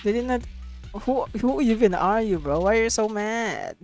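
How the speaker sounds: ordinary talk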